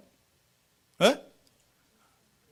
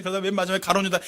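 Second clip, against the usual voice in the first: normal talking voice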